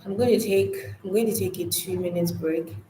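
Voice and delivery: ordinary delivery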